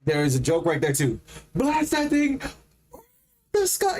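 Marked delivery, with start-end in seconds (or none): 1.6-4.0 mock repeat. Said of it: "I just basically repeated that".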